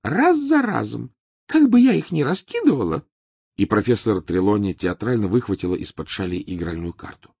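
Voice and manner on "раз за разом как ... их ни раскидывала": Trelawney voice. Acted.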